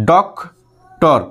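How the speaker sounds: Doctor very slowly, ডক, long pause, টর; syllable break. Shown as a demonstration. Teacher shows two parts to students, slow doctor with a long break. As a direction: hold a long gap inside doctor. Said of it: "দুটো পার্টে, তাহলে".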